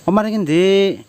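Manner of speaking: ordinary talk, nothing marked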